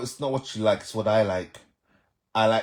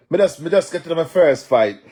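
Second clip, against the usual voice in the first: patois shout; 8 semitones higher, 8 dB louder than usual. Acted. Patois line, then restated in standard English.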